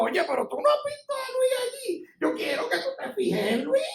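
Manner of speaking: high-pitched playful call; God addressing the devil. Acted. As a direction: call out proudly, playing God. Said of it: "cuando Dios quiere decirle al diablo".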